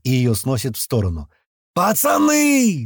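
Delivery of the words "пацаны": high, long drawn-out yell; yelled call. A narrator's reading. Men calling their lads all at once, drawn-out yell. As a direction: stretch the word out in a high holler. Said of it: "заорали мы все разом".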